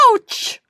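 a sudden high ouch from the fall; short high cry of pain as she hits the ground; yelp of pain. A narrator's reading. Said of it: "landed on her nose".

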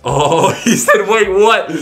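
high laughing exclamation. Real, not acted. YouTuber reporting the streamer's reaction to viewers, laughing. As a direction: burst out laughing through the words.

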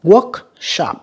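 "Workshop" with WORK punched; first-syllable stress. Shown as a demonstration. Teacher showing students word stress, WORK-shop with the first syllable stressed. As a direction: hit WORK, let "shop" drop. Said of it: "âm một nha".

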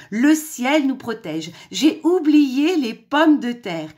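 high, slow, loud dismay; exclaiming. A narrator's reading. Mother hen realising she forgot potatoes, exclaiming slowly. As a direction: lament loudly over something forgotten. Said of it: "s'exclama la mère poule".

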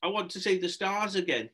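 high, mild mock yell; yelled demand. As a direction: whine it as a held-back yell. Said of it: "he yelled".

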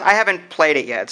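ordinary delivery

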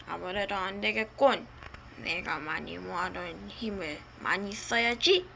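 low, soft sinister muttering; dark lord. Acted. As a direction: growl it low like a villain. Dark lord decides to use power.

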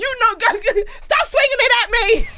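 high laughing protest. Real, not acted. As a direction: squeal it through laughter.

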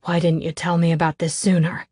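ordinary delivery

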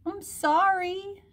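put-on very high, drawn-out child voice; little Harriet. A narrator's reading. Harriet apologizing to her mother, slow high child voice. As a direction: stretch it out in a tiny high voice. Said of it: "said Harriet".

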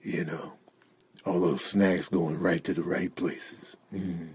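low, hushed, slow purr; creepy seduction. Acted. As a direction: savour each phrase with hungry longing. Seductive aside to Theodore.

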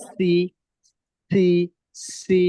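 সি said with air flowing out; breathy si. Shown as a demonstration. Teacher showing students the airy letter. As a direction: let the air escape on সি. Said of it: "বাতাস বের করে সুন্দর করে পড়বেন".

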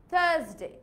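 British "thuhs-day" without R; British silent R in থার্সডে; British accent. Shown as a demonstration. Teacher repeating it for learners, British accent. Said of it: "আমি কি আরটাকে উচ্চারণ করেছি?".

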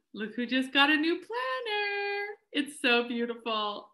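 high sing-song, delighted; sing-song excitement. Real, not acted. Showing off her new planner.

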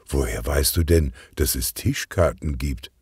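plain reading voice

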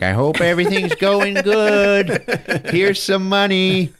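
high, slow singsong put-on voice; hammed-up cheery well-wisher, drawn out high; comic letter-writer. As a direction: read a letter in a silly, high, stretched voice.

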